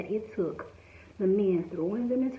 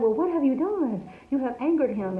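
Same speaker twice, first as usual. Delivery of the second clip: accusing sailors; 5 semitones higher, 3 dB louder than usual. Acted.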